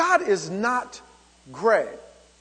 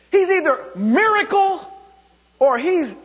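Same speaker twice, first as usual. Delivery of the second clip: higher and louder, a sudden burst on miracle; preaching climax; 7 semitones higher, 4 dB louder than usual. Real, not acted. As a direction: lift it up with fire. Preacher climaxes on key word.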